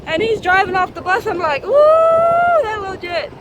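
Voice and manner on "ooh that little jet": very high, loud ooh then falling; alarmed shout. Retold or imitated. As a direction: cry out as the bus rolls off. Kid drives off.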